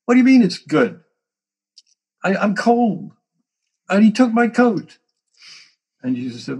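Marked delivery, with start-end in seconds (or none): 0.1-5.0 loud, high, indignant protest; outraged disciple shouting over his stolen coat; angry disciple. Retold or imitated. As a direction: bark the complaint like a robbed man. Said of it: "I'm angry".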